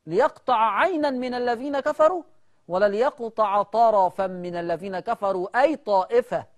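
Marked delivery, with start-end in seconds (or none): none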